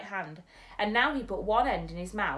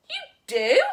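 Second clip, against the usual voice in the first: louder, higher hopeful cry; delighted cry; 7 semitones higher, 12 dB louder than usual. Acted. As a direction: brighten up suddenly. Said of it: "cried the B F G".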